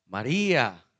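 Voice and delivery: low, loud calling of a name; recognisable call. Acted. Jesus makes Mary recognise his voice, calling strongly. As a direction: call the name out firmly, with a falling end. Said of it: "era una voz reconocible".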